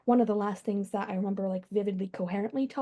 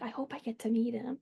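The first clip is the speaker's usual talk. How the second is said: soft whisper of a dying mother; faint whisper, mother's final hope; whispered last words; 7 dB quieter than usual. Retold or imitated. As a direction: breathe it like her last wish.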